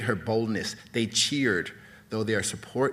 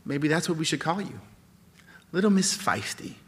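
mocking; 7 semitones higher than usual. Announcer mocks the prisoner.

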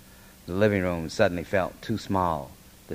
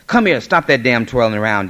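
loud, raised fatherly command; angry yell; 5 semitones higher, 10 dB louder than usual. A narrator's reading. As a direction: yell at the child to quit it. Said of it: "Daddy yelled".

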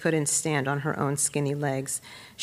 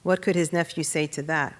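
ordinary delivery, nothing marked

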